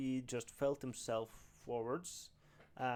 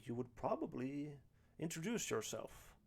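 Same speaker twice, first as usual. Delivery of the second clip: ordinary talk, nothing marked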